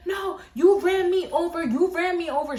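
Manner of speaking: insistent shouting lady. Retold or imitated. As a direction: shout it back at the driver. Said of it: "She kept saying that".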